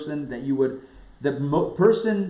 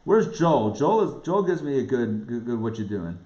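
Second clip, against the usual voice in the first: preaching at full force; 7 semitones higher than usual. Acted. Rabbi urging his students, preaching at full force. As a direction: rouse the listeners with every line.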